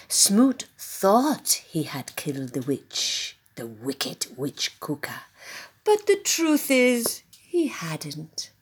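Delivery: suspenseful whisper. Acted. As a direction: whisper the secret.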